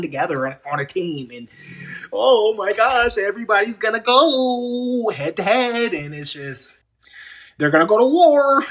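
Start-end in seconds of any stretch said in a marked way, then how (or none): 2.1-8.6 much higher, louder mock excitement; sarcastic announcer hype. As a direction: ham up fake enthusiasm. Mocking hype for War Games.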